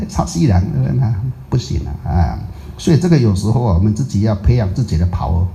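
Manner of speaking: ordinary talk, nothing marked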